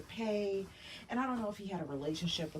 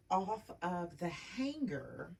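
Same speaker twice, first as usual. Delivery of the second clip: ordinary talk, nothing marked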